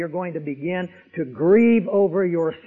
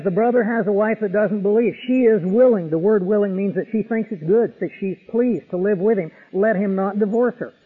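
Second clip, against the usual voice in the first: louder, ringing pulpit voice quoting Paul; raised preacher voice reading scripture aloud; full-force preaching; 4 semitones higher, 7 dB louder than usual. Acted. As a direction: proclaim the verse to the whole hall. Preaching Paul's words at full force.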